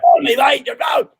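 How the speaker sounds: high, shouted mock Cockney; Cockney accent. Shown as a demonstration. Guest teasing a host about British accents, shouted Cockney accent. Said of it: "better than sounding Cockney".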